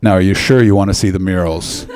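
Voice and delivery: low-voiced ominous Irish cabbie; deep, cautioning question in character; Irish taxi driver. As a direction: warn as a knowing old driver, voice dropped. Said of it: "when he went".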